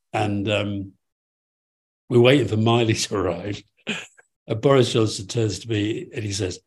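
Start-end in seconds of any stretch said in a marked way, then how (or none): none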